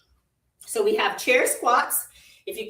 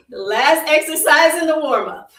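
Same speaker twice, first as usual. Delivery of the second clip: said through laughter, a bit louder; laughing; 4 semitones higher, 6 dB louder than usual. Real, not acted. Instructor answering the teasing, still laughing. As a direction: keep laughing through the words.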